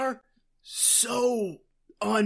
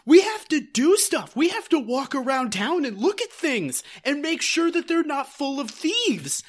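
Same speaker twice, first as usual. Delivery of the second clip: mock urgent; 6 semitones higher, 3 dB louder than usual. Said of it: "Andy is like".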